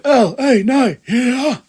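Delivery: loud, high drawn-out ring call; LA Knight catchphrase. Retold or imitated. Recapper introducing a match, mock catchphrase shout. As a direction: belt it like a wrestler's catchphrase. Mimics the wrestler's name and catchphrase.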